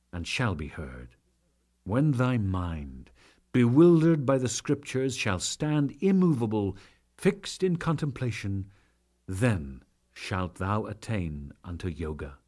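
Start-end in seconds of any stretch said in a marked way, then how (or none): none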